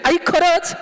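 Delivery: high squeal of delighted disbelief; amazed child. Acted. Son learning who his father is, high and amazed. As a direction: pitch it way up like an astonished little boy.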